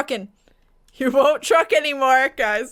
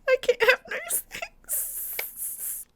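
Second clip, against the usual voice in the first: very high, soft, drawn-out whimper; self-pitying whine; 9 semitones higher, 7 dB quieter, much slower than usual. Real, not acted. Player lamenting bad luck, high slow whimper. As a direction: squeak it out slowly, as if about to cry.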